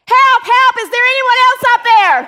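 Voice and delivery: very high, loud, fast cry for help; panicked mock yell. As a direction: shout past God for someone else. Punchline, man yells for other help.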